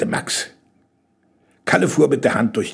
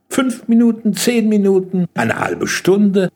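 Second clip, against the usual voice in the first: put-on high-pitched boy's voice, listing; boy suggesting estimates; 7 semitones higher, 3 dB louder than usual. A narrator's reading. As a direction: offer guesses one after another. Max offers estimates impatiently.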